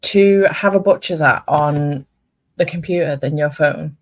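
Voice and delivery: ordinary delivery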